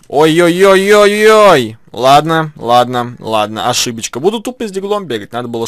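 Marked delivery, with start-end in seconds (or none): 0.1-1.8 panicked. Real, not acted. Panicked in a firefight.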